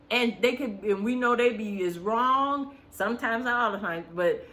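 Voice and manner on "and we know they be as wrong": normal talking voice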